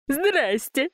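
high, whooped hello; whooping greeting. A narrator's reading. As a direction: whoop it out clumsily. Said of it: "гикнула Зинаида".

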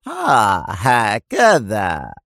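drawn-out mocking "oh, is that so"; slow, knowing sarcasm; sarcastic. A narrator's reading. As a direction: stretch it slowly with irony. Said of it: "وهو يقول في سخرية".

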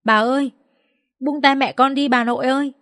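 put-on high, small pleading child voice; sobbing child. A narrator's reading. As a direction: beg grandma tearfully, lightly acted. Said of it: "Tiếng Bo khóc nức nở".

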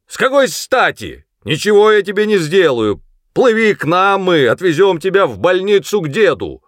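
high, loud coaxing call; shouted persuasion. A narrator's reading. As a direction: shout reassurances across the river. Sergeant shouts reassurance across river. (громко уговаривает криком издалека)

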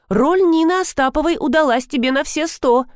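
high-pitched admiring exclamation; exclaiming. A narrator's reading. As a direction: exclaim it with delighted praise. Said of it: "воскликнула Маргарита".

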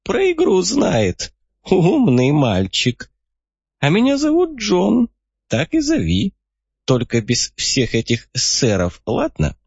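put-on high, slow sly old codger; a crafty old man pleased with himself. A narrator's reading. Old man John speaks.

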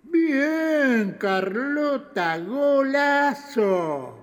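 high, slow, drawn-out cheer; excited cheer. Acted. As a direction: savour the win, stretching each word. Festeja la respuesta de Carlota. (festejo entusiasmado y estirado)

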